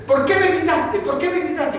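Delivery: high, sharp scolding from an imitated angry father; padre regañando. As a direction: demand an answer from the little son, stern. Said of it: "Lo tenía que levantar y le decía". (padre enojado regañando al hijo, en voz aguda)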